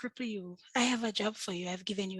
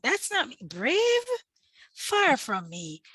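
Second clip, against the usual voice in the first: higher, louder, disbelieving brave; incredulous high protest; 6 semitones higher, 8 dB louder, slower than usual. Retold or imitated. Telling the lady she is not brave, high incredulous protest. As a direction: scoff at being called brave, pitch shooting up. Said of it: "I was like".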